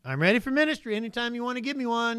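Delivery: high, quick, sing-out offer; mock readiness. Idle believer telling God he is ready, mock eager. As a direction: pipe up to God without any effort.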